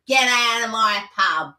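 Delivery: slow, loud London pub landlady; Peggy Mipp. Acted. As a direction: throw them out in drawn-out Cockney. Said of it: "I only think of Peggy Mipp".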